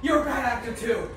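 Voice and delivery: usual speaking voice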